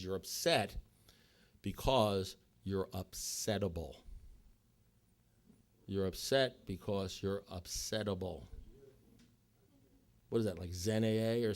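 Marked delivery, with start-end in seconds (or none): 0.0-8.5 slow, low, spaced-out verdict; deliberate pronouncement. Retold or imitated. Sponsor finally telling him why he's upset, slow and deliberate. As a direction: hand down the answer slowly, pausing before the reason. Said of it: "He says, "Listen, Russell".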